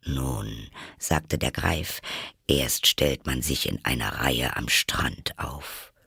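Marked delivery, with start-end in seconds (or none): 0.0-0.5 low, soft creature voice; Griffin voice. Acted. Griffin remarking on the choking turtle, low character voice. 2.5-5.9 low, soft creature voice; Griffin voice. Acted. Griffin remarking on the choking turtle, low character voice.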